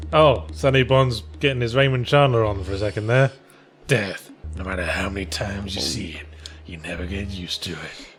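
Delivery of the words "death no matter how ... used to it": noir narrator. Pretend. Noir detective voiceover parody.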